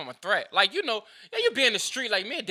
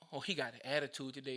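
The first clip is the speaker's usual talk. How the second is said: soft, lower, quick mock coworker; mock complaining coworker; 6 semitones lower, 12 dB quieter, faster than usual. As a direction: mumble it like a petty complainer.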